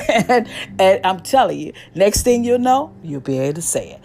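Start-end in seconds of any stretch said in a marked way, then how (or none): none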